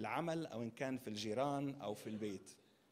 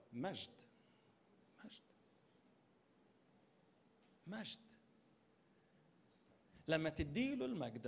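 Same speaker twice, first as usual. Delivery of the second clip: ordinary talk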